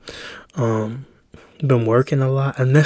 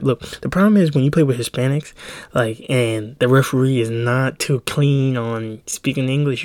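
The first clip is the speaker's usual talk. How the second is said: normal talking voice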